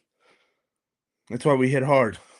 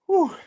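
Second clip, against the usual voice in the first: breathless whew. Real, not acted. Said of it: "I'm out of breath".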